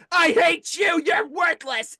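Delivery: high, loud, spiteful mimicry; hateful demon. Demon in the girl attacks exorcist, high hateful outburst. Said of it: "and, like, speaking to me".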